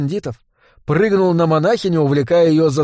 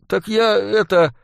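put-on high, hesitant boy's voice; stalling boy; 6 semitones higher, slower than usual. A narrator's reading. Cornered boy making excuses to dealer, faltering.

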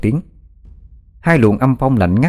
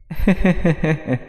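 high, breathy ghostly cackle, fading; mock the victim with a cold laugh; eerie laugh; 8 semitones higher, 3 dB quieter than usual. A narrator's reading. The demon laughs at frightened Bích, eerie cackle.

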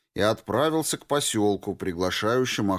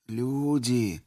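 put-on old man voice; 3 semitones higher than usual. A narrator's reading. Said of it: "повторил Лысый".

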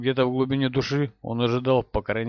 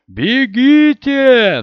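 loud desperate shout; 12 semitones higher, 12 dB louder, much slower than usual. Acted. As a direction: yell it high and drawn out. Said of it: "и Том закричал".

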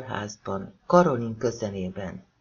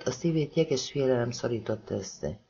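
ordinary delivery

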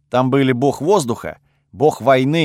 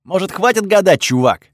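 higher, pushed, fed-up question; exasperated; 6 semitones higher, 4 dB louder than usual. Acted. God tired of the narrator's guesses, exasperated. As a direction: cut off the guessing game.

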